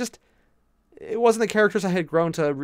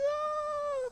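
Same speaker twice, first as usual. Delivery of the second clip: giddy high squeal; 8 dB quieter than usual. Real, not acted. After announcing engagement.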